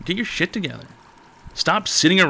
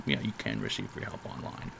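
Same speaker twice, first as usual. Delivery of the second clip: deep goofy voice; 16 dB quieter than usual. Pretend. Goofy repeat of stream slogan.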